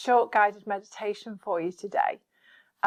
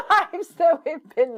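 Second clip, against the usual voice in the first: higher, amused laugh in the words; 8 semitones higher, 5 dB louder than usual. Real, not acted. Speaker on the illusionary lives we lived, laughing. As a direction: talk through a light laugh.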